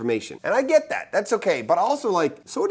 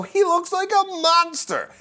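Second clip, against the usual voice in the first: emphatic high; 14 semitones higher, slower than usual. Real, not acted. Exaggerated monster image.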